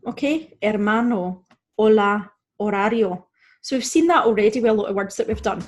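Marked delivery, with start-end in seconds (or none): 0.6-3.2 Hermano, hola, horario starting straight on the vowel; Spanish Hermano, hola, horario with no H sound; silent H. Shown as a demonstration. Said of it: "it's generally silent".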